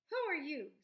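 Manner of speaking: a sharp, loud demand from the dark; loud challenge. Acted. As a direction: call it out loud, startled and wary. Hidden creature challenges intruders.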